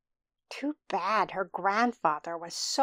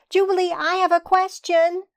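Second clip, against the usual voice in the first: high, quick, louder call; 9 semitones higher, 7 dB louder, faster than usual. A narrator's reading. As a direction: call out eagerly to the passing babysitter. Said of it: "Maya called".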